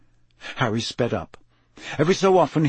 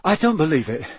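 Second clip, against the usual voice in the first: agitated, high, breathless account; loud, high-pitched shock after an attack; panting, shaken; 6 semitones higher, 4 dB louder than usual. A narrator's reading. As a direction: blurt it out shaken, voice climbing. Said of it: "panted Cedric".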